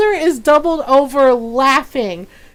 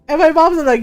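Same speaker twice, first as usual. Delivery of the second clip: ordinary talk, nothing marked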